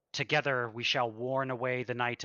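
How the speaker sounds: ordinary talk, nothing marked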